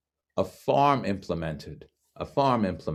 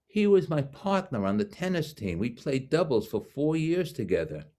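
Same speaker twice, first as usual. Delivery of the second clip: high-pitched imitated New York doctor; New York accent; 6 semitones higher than usual. Doctor recalling his tennis partner in a high New York accent. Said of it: "the most New York accent".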